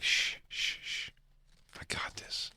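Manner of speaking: hushed whisper to calm him; soothing whisper. Retold or imitated. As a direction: hush the patient gently. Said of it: "she would be like".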